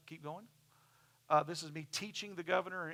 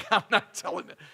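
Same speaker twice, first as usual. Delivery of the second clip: laughing; 2 semitones higher, 9 dB louder than usual. Real, not acted. Refusing to share the inside joke, laughing. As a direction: laugh first, then refuse with a laugh.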